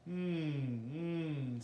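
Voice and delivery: hummed swoops up and down; humming glides. Shown as a demonstration. Teacher moving up singers' voices, humming glides. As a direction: hum and let the pitch slide. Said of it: "just trying to move up your voice".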